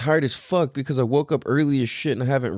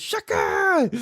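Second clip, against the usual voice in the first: put-on much higher, proud little-kid shout; toddler shout. Dad imitating his son greeting surfers, high little-kid shout. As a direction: call it out like a proud small boy. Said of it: "So he goes".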